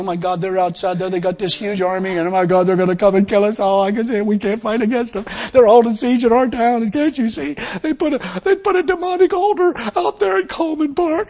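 preacher mocking a fearful believer's frantic prayer; mock panic. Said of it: "weeping and crying, saying".

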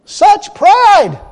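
high, loud cry of condemnation; loud indignation. Real, not acted. Preacher denouncing the woman's pride, loud indignant cry. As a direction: proclaim it to the whole church.

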